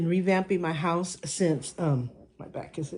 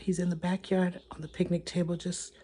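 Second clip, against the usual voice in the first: normal talking voice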